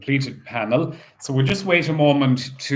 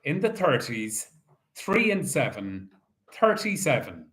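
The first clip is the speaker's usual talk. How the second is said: bingo caller. Acted. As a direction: call each ball out steadily. Calls bingo numbers.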